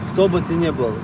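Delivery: ordinary talk, nothing marked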